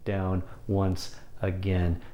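drawn-out, low and soft; slowing down again. Shown as a demonstration. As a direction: brake hard into a slow, quiet pace. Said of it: "and then slow down".

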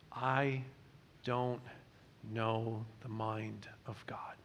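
slow, breathy whisper of humility; whispered confession. Real, not acted. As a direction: drop to a hushed whisper, admitting not knowing. Confessing not knowing God's mind.

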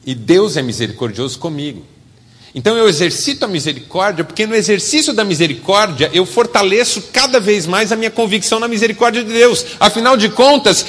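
loud, high impassioned preaching; fiery pulpit delivery; forceful preaching. Acted.

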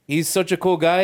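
ordinary delivery

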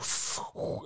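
rasping Darth Vader breath. Shown as a demonstration. Explaining Vader breathing, a rasping breath. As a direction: exhale hard like Vader's mask. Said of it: "and then you went".

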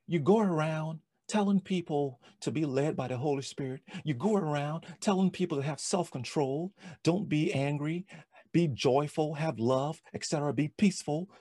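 acted-out, insistent self-accusation; accusing inner voice. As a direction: berate like a voice in someone's head. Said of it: "these voices start hitting me".

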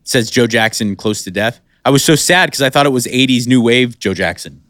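usual speaking voice

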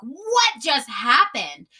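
loud, very drawn-out question; stunned disbelief. Retold or imitated. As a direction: stretch it out in exaggerated shock. Retelling her shocked reaction.

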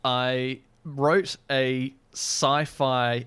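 ordinary delivery

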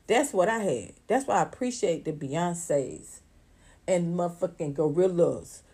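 ordinary delivery

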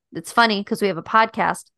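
usual speaking voice

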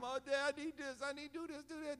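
put-on high pleading childish voice; mock a needy kid begging Dad; whiny begging child. Pastor mimicking believers begging God like kids, high and whiny.

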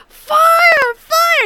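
high, loud theatrical scream; screamed cry. Retold or imitated. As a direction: act out a woman screaming at a fire. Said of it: "And so she screams".

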